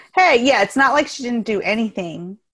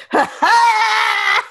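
mock reaction laugh; 11 semitones higher than usual. Shown as a demonstration. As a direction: bark out a TikTok reaction laugh. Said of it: "sit here and go".

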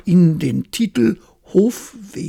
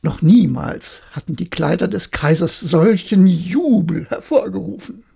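ordinary delivery

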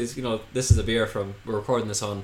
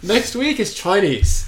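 excited; 8 semitones higher, 7 dB louder, much slower than usual. Real, not acted. Joking announcement.

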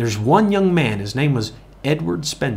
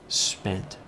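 whispered emphasis; 5 semitones lower, 10 dB quieter than usual. Real, not acted. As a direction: breathe the word out in a whisper. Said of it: "and I mean this".

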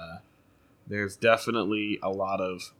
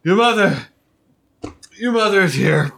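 high, loud excited cry; excited announcement; 12 semitones higher, 16 dB louder than usual. Acted. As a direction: burst in with the news, high and loud. Belvedere announces the mother.

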